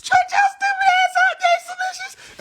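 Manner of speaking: shrieked high-pitched put-down; high mock shout. Comic telling off rappers, high mock shout. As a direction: yell it way up high in mock outrage.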